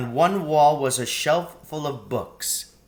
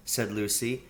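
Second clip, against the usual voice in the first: plain reading voice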